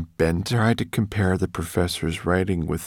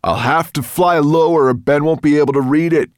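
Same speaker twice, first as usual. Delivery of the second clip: loud, raised dragon call; high-volume announcement to the riders; 9 semitones higher, 10 dB louder than usual. A narrator's reading. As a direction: call back over a distance. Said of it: "called Firedrake".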